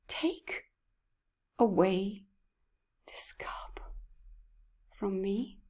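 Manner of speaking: Jesus praying in Gethsemane, whispered and heartbroken; heartbroken prayer. Retold or imitated. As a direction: plead with the Father, voice giving way. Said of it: "with a prayer and a breaking heart".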